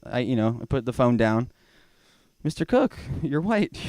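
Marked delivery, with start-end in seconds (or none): 2.4-3.8 higher, breezy agent voice; TSA agent. Retold or imitated. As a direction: wave him through with a lightly voiced agent. Agent voiced waving him through.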